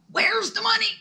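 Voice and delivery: high, loud mock shriek; hammed-up screech of an irate in-law; mother-in-law's angry yell. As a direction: imitate a furious mother-in-law yelling a demand. Mother-in-law storms up demanding gifts.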